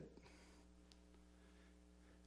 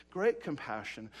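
normal talking voice

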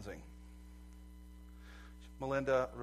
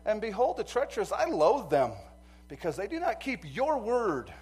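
loud, raised preaching voice; fervent preaching; 9 semitones higher, 13 dB louder than usual. Acted. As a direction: lift the verses up with fire.

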